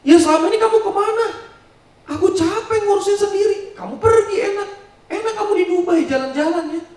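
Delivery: high, loud angry retort; husband shouting back. Acted. Husband blaming the absent wife, furious high shout. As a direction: fire the blame right back at her. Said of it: "Suaminya marah balik".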